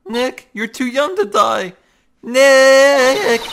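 high, loud desperate wail; anguished cry. Acted. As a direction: call out to a fallen friend. Friend seems dead.

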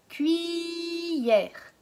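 Cuillère said slowly, leaning on each sound; slow clear modelling. Shown as a demonstration. Teacher models Cuillère for sound hunting. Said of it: "Est-ce que dans cuillère".

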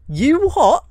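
squawked high and loud; outraged halfling barmaid, pitch jumps way up; indignant you what. Acted. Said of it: "Alfreda turns to you and goes".

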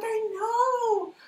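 high, loud, hammed-up whining protest; mock wail. Boss answering her yelling assistant, mock wail. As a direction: wail back in comic despair.

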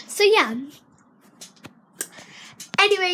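normal talking voice

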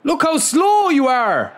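drawn-out frustrated shout at the screen; exasperated yell. Real, not acted. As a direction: stretch it out in disbelief at the slow player. Yelling at slow player.

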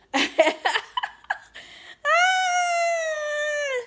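cackle, then hold a loud high scream; overjoyed laughing squeal. Real, not acted. Overjoyed outburst about milestone.

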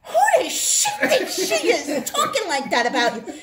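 mock outraged friend, defending her; a hammed-up fit of protective anger; retold indignant outburst. Friend fuming at the clerk on her behalf, mock outrage. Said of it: "So she goes".

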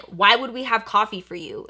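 ordinary talk, nothing marked

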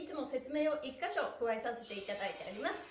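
ordinary talk

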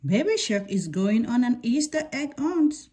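ordinary delivery, nothing marked

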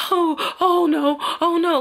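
high, loud, slow frightened wail; a loud drawn-out wail of panic; panicked oh no. Retold or imitated. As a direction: cry out in fear while running. Scared girl running across street.

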